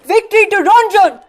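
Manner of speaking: high, loud rallying cry; battle cry. Acted. Nandini charging to her death, loud rallying cry. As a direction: hurl it out as a defiant slogan.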